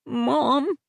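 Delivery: put-on small child's voice; young boy. Aiden's child voice.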